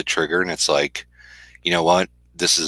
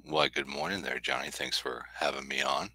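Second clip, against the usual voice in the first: sleepy voice; 12 dB quieter than usual. Real, not acted. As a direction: talk like you just rolled out of bed. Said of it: "sleepy [name] voice this morning".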